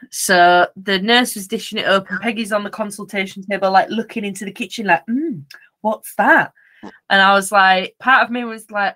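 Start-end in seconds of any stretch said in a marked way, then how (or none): 5.1-6.5 slow, sniffing puppy wondering about food; curious dog voice. Pretend. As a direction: voice the dog eyeing the kitchen slowly.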